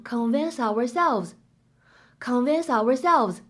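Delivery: "convince ourselves" with the s carried over, "convin-sourselves"; linked reading. Shown as a demonstration. Teacher models linking in "convince ourselves". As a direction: carry the s into "ourselves". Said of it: "你呢可以读成".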